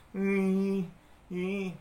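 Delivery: high little whimper on ih; mock crying child. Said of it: "sambil nangis". (rengekan anak kecil pura-pura menangis)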